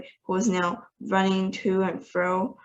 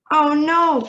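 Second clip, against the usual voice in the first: a higher, louder dismayed cry; cried; 8 semitones higher, 7 dB louder than usual. A narrator's reading. Mother horrified about her son's toes, loud higher cry. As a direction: cry out in horror. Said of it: "cried Missus T V".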